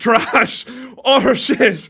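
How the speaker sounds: loud, high mock outrage; loud outburst. Real, not acted. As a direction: blurt it out, outraged. Repeats verdict much louder.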